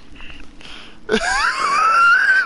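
very high screaming cackle; shrieking laugh. Real, not acted. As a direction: let out a wild shrieking laugh. Wild laugh after rant.

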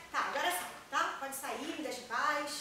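fed-up demand to be left alone; irritated dismissal. Acted. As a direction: send her away, loud and impatient. Daughter orders mother out.

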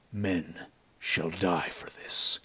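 slow, quiet, cold vow; coldly measured, softened threat. A narrator's reading. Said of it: "he said coldly".

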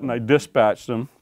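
usual speaking voice

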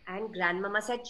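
usual speaking voice

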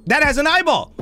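high, happy yell of discovery; excited discovery. Real, not acted. Gamer spotting the eyeball, excited shout. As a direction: announce the find with glee.

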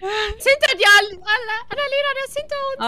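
loud, fast panicked warning; being chased. Real, not acted.

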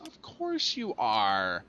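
very slow, stretched COURSE and ARE; drawn-out sarcasm. Real, not acted. Player finding the vehicle stuck, drawn-out sarcasm. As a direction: drag out "Of course you are" at half speed.

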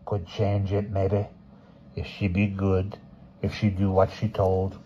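put-on cawing old crone; old crow voice; very old woman. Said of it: "cawed like an old crow".

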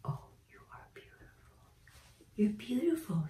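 soft whispers and high coos to a shy bird; whispered coaxing. Real, not acted. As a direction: coax her out in a whisper.

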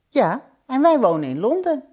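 put-on high, eager child's voice; little boy. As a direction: answer as an eager small boy. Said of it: "zei het jongetje". (gretig jongetje, hoge stem)

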